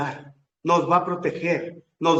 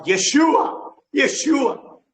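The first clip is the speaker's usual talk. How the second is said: high, loud cry of the name; desperate cry for help; 11 semitones higher, 7 dB louder, much slower than usual. Retold or imitated. Drowning believer calling Yeshua to save him, loud high cry. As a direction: call out as if the water is rising. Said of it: "Porque él gritaba".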